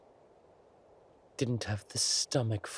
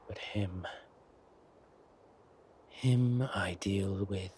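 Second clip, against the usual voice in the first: menacing whisper. Acted. King vows to punish the Duke.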